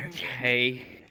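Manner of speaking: low, drawn-out imitation; mock slow, reluctant agreement; Ethan giving in.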